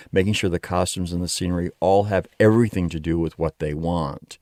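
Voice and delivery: ordinary talk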